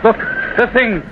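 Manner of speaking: frightened. Acted. Frantic man seeing the ghost.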